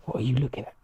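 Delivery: breathy whisper under the breath; whispered thought. Retold or imitated. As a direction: mutter it as a hidden thought. Inner thought when stared at.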